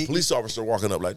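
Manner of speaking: ordinary delivery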